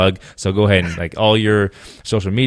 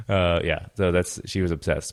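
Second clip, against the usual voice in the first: ordinary delivery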